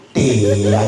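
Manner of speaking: low, long-drawn mock answer; mimicking the drone. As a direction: stretch the word out low and level. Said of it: "Hampir kayak bilang begini".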